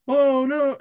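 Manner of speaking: mock panic. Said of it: "they're like".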